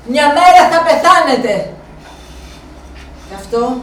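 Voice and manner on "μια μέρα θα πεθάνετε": louder, «μέρα» hit hard then falling; louder grim certainty. Retold or imitated. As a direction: insist on it, a grim promise. Inner thought, said again louder. (πιο δυνατά, βέβαιη απειλή, τονίζοντας το «μέρα»)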